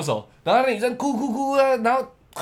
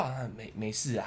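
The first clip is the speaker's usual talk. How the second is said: much softer, lower hushed comforting murmur; mock whisper; 10 semitones lower, 15 dB quieter than usual. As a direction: whisper it like secret consolation. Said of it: "跟他窃窃私语". (模仿黑柱小声安慰女生)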